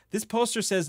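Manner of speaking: usual speaking voice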